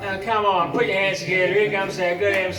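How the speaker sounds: loud, rousing MC introduction; announcer call. Acted. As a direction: whip up the crowd. Introduces the MC to crowd.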